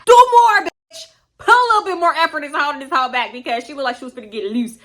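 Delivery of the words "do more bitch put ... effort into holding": high, loud burst; sudden high-pitched shout; exasperated yell. Retold or imitated. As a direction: yell it at someone barely trying. Urges friend faking restraint.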